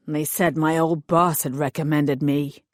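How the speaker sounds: hired thug voice. Acted. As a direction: play the sulky hired heavy explaining himself.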